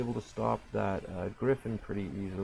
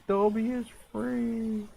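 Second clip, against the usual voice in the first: much higher, slow, squeaky house-elf voice; squeaky Dobby; 11 semitones higher, slower than usual. Pretend. Repeats the Dobby quote.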